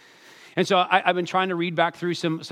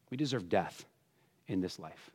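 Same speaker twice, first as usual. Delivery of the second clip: hushed; 8 semitones lower, 11 dB quieter than usual. Real, not acted. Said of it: "preacher drops voice for weight".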